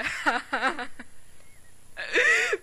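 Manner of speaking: drawn-out mocking laughter; long laugh. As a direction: keep laughing at them. Laughs at the other.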